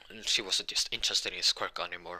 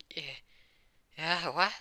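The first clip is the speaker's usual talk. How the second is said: flustered reply jumping higher at the end; 8 semitones higher, slower than usual. Acted. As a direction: act caught off guard and embarrassed. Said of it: "Deku would be red in the face".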